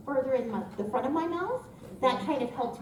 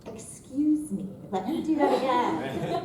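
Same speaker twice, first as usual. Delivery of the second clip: slow, deliberate enunciation; careful self-correction; slower than usual. Retold or imitated. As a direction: correct yourself slowly, word by word. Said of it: "I'm like".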